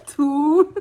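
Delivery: loud laughing count. Real, not acted. Recalling passing out mid-count, bursting into loud laughter.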